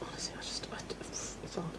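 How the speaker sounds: a voiceless whisper, barely audible. Real, not acted. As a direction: breathe it out under your breath. Whispered aside.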